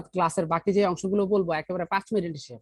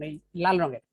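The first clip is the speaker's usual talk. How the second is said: ordinary talk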